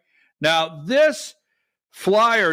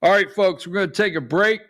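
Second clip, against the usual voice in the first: ordinary talk, nothing marked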